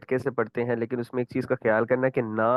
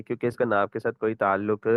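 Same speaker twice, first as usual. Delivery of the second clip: ordinary talk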